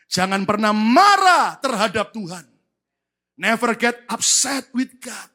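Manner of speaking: slow, loud repeated warning; slow, loud preacher emphasis; slow emphatic. Real, not acted. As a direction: press every word slowly.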